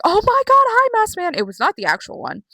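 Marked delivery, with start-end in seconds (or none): 0.0-1.3 excited shriek. Retold or imitated. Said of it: "I was like".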